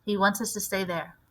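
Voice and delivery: plain reading voice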